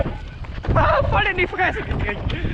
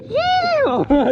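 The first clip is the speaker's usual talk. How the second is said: cheering; 8 semitones higher, 3 dB louder than usual. Real, not acted. Cheering after the hard section.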